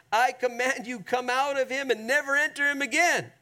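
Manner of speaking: high, firm words of command; commanding rebuke. Acted. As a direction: order it out with authority. Jesus rebuking a spirit, quoted.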